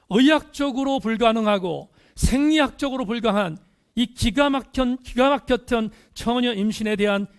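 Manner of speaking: high, loud pulpit voice; forceful preaching. Real, not acted. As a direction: hammer out each phrase to the congregation. Preacher marvels at virgin conception.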